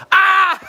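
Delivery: high joyful shriek; excited shriek. Real, not acted. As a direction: burst out with delight. Excited outburst.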